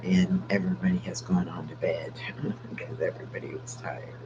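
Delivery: hushed whisper so the sleeping family stays asleep. Real, not acted. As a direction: breathe the words out, no voice.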